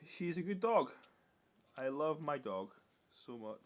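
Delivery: usual speaking voice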